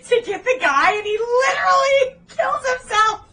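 very high, loud exclamation; outraged disbelief. Real, not acted. Podcaster ranting that the chase was pointless, outraged cry. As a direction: cry out at the sheer waste.

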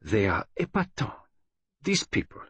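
put-on high, swooping Belgian accent; admiring Belgian detective. Detective admires the gang's psychology to friend, high and marvelling.

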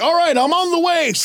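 high-pitched mock-heroic call; heroic Superman. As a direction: announce yourself as the rescuer. Superman dashing to the rescue.

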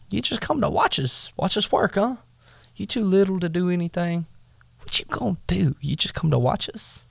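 high, teasing jeer; mocking brothers. Brothers jeering at little David for watching, high mock. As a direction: taunt him like he's too little.

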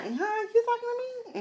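hammed-up surprised comeback. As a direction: act offended, then curious. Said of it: "So I'm like".

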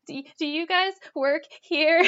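high, halting retold crying question; mock tearful. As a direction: imitate yourself asking for help through tears. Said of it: "And I'm just crying".

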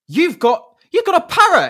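a pilot shouting at a passenger; indignant shout. Retold or imitated. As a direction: yell it in shocked outrage. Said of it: "he's like".

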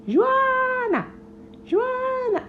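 high calling of Joana from a distance. Acted. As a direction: call her name across the hive. Parent calling from afar.

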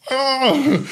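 high, knowing oh; mock sudden realization; surprised oh. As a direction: let it pop out high. Retelling own reaction.

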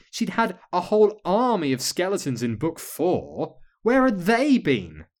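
put-on exasperated child ranting at a book; incredulous, emphatic griping; indignant grumbling. A narrator's reading. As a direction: complain with rising outrage, pitch climbing. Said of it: "Still grumbling".

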